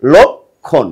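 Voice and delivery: লক্ষণ as "lokkhon", said slowly; লক্ষণ modelled as "lokkhon"; model pronunciation. Shown as a demonstration. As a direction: give লক্ষণ as "lokkhon", the answer. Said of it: "তাহলে কি হবে?".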